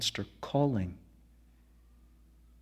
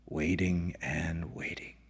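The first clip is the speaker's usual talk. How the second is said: slow, hushed whisper trailing off; 6 semitones lower, 6 dB quieter than usual. Acted. Keeper whispering to the narrator about the waiting beast. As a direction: breathe it out like a lonely secret. Old keeper's fading lament.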